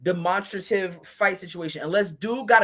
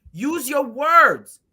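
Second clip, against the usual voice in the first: slower and louder; slow scold; 5 semitones higher, 6 dB louder, much slower than usual. Retold or imitated. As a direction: drag out use your words.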